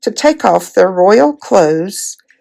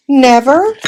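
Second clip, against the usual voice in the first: higher, louder cry of dismay; 6 semitones higher, 4 dB louder than usual. A narrator's reading. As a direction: gasp it out in dismay. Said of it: "cried Edmund and Lucy in dismay".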